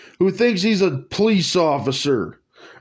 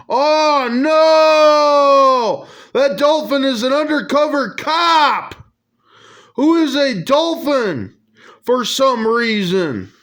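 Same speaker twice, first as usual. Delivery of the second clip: shocked; 7 semitones higher than usual. Acted. Shocked reaction to cop dolphin.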